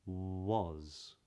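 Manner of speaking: "Was" with a long oo-like W at the start; rounded W. Shown as a demonstration. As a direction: linger on rounded lips, then open into "Was". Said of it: "try that in these words".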